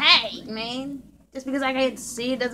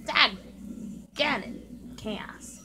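whispering; 7 dB quieter, much slower than usual. Real, not acted. Unvoiced muttering.

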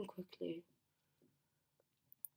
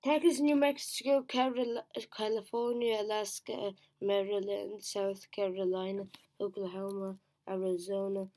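rushed; 7 dB louder than usual. Real, not acted. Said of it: "I have to do them fast now".

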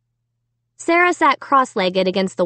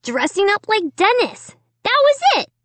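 high-pitched, insistent protest; insistent boy; 11 semitones higher than usual. A narrator's reading. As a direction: insist on it in a much higher voice. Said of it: "he insisted".

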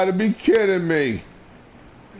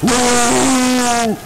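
loud pained yelp; 8 semitones higher, 7 dB louder than usual. Real, not acted.

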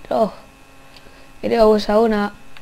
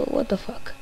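softer, under the breath; muttered disbelief; 7 dB quieter than usual. Real, not acted. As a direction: say it to yourself quietly. Repeats reaction under breath. (incredulidad en voz baja)

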